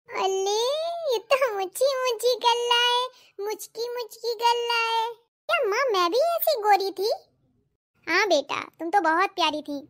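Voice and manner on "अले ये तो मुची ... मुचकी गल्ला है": put-on baby talk. Girl baby-talks to newborn sister.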